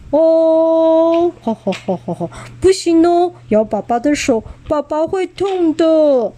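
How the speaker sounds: T-rex dad gently telling his babies not to bite, doting; playful dad. Acted. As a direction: tease the biting babies fondly. T-rex father playfully scolding babies.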